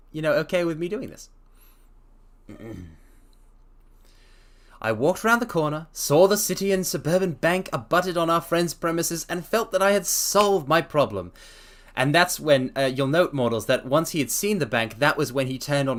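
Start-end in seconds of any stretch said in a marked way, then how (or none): 4.8-11.3 reading a story aloud, lightly voiced Holmes. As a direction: read the detective's explanation steadily. Reads Holmes's explanation aloud.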